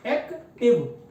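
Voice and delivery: Active broken into एक, pause, टिव; syllable by syllable. Shown as a demonstration. Teacher showing students the segments, active split in two. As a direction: say active as two separate beats. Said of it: "मैं अभी बताता हूँ आपको".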